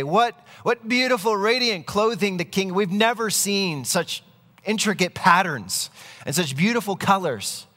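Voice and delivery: high, gushing crowd praise; feigned awe. Acted. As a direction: pretend to marvel, pitched well up. Crowd pretends to admire invisible clothing.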